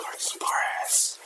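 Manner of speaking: slow whisper of the name. Acted. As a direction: whisper it slowly, like a secret.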